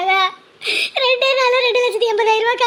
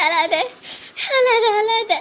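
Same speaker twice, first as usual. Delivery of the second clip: normal talking voice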